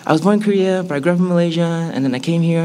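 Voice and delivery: high-pitched explaining. Retold or imitated. Voices self reciting complicated origins.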